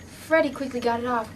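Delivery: ordinary delivery, nothing marked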